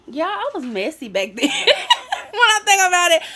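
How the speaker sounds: amused aside breaking into high laughter; laughing confession. Real, not acted. Storyteller admits to viewers she was messy, laughing as she talks. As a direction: laugh while owning up. Laughing at her past self.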